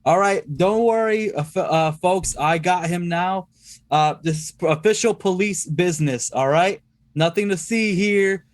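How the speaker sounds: detective. Acted. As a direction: play the officer reassuring a crowd. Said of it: "the detective and be like".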